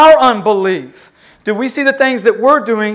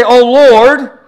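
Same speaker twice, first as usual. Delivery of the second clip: louder call for the congregation; 2 semitones higher, 7 dB louder than usual. Shown as a demonstration. Preacher leading the church in a loud cry. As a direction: lead the people in crying out. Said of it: "everyone say".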